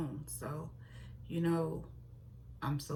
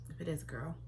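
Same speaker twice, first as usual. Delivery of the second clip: ordinary delivery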